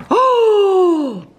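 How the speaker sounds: sudden high, loud "Oh!"; surprised gasp. Real, not acted. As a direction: caught off guard by a find. Sudden find in the freezer.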